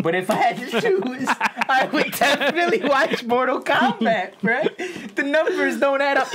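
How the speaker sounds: high and loud, talking through real laughter; can't stop giggling while making the point; laughing. Real, not acted. Defending Mortal Kombat to friends, laughing through the words.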